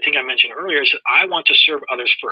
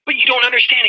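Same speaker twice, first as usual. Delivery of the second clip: protesting; 9 semitones higher than usual. Retold or imitated. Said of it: "I go".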